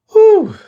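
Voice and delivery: very high, loud gasping exhale; winded whew. Real, not acted. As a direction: puff it out after a hard round. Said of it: "out of breath".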